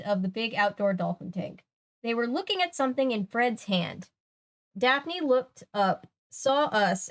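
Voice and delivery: animated read-aloud, deliberate phrasing; story narration. A narrator's reading. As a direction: read the mystery out to listeners with lift.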